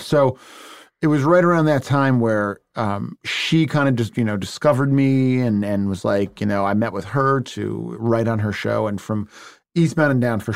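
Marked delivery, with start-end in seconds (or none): none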